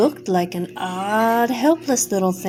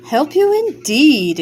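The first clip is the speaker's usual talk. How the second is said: scornful exclamation; 4 semitones higher, 4 dB louder than usual. Acted. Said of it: "exclaimed Medio Pollito".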